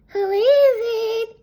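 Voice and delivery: wolf playing granny, drawn out; crackly voice. A narrator's reading. Wolf in bed calls to the knocking girl in a crackly fake granny voice. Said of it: "he called in a crackly voice".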